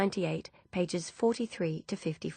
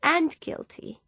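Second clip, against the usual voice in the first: high, tearful catch on the word; young and fragile; 10 semitones higher, 4 dB louder, slower than usual. Acted. Ursula admits guilt to Grace, young and fragile. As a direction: let it crack out small and fragile. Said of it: "suddenly very young and fragile".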